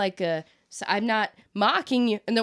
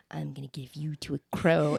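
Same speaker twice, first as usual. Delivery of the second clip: whisper; 7 semitones lower than usual. Retold or imitated. Talking to the dead rat.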